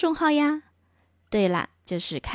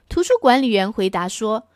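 plain reading voice

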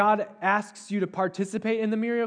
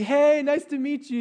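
high, loud, cheery hello; overfriendly greeting; 9 semitones higher, 5 dB louder than usual. Acted. Host stalling surprise guest at the door, overfriendly.

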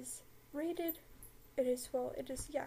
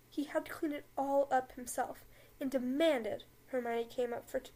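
usual speaking voice

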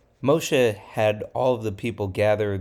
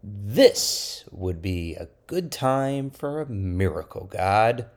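slow, drawn-out plea; slower try. Acted. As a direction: ask again slowly and deliberately. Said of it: "Let me try it like this".

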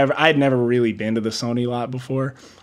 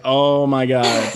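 drawn-out relieved oh my God; slow relieved sigh; slower than usual. Retold or imitated. Driver relieved after finally finding the building, slow and drawn out. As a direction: let the relief stretch out.

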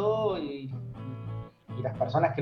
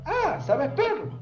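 higher-pitched mock excitement, drawn out; acted eager question; 11 semitones higher, much slower than usual. Recruiter pouncing on a candidate who knows Perl, mock excited.

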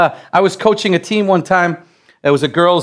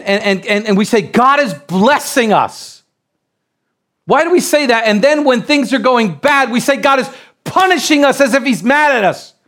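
fired-up preaching; 4 semitones higher than usual. Acted. Preacher urging the church through tough times, rising to a shout. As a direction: drive it home to the congregation.

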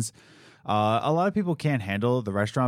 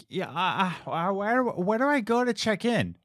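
lady customer voice; 8 semitones higher than usual. Retold or imitated.

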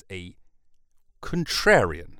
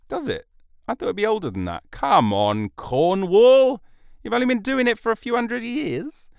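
high-pitched comic incredulity; mock disbelief; 12 semitones higher, 7 dB louder than usual. Podcaster chiding Cornwall over its history, mock outraged. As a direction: scold Cornwall in a squeaky, loud protest.

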